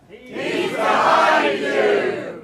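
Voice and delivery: slow drawn-out shout of behind; panto crowd callback. Acted. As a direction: yell the panto warning together. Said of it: "Give me one of those".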